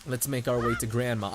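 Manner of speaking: ordinary talk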